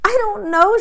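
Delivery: high, loud choked sob of words; tearful, high choking girl; choked crying. A narrator's reading. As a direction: cry it out. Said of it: "her voice choked".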